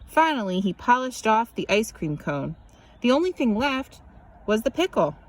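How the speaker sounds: ordinary delivery, nothing marked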